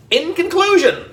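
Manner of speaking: mayor addressing the TV camera; pompous politician. A narrator's reading. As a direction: announce it like a self-important mayor. Mayor speechifying.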